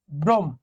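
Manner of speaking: Brahma halted at the m; syllable break. Shown as a demonstration. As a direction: stop short on the first syllable of Brahma, "brom". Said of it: "তোমাকে থামতে হলে".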